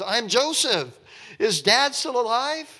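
high, loud, hammed-up breakdown; mock wailing. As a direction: blurt it out as if falling apart. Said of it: "he just breaks down".

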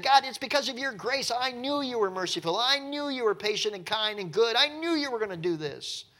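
a hint of a sulky Jonah; mocking Jonah. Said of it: "you just said".

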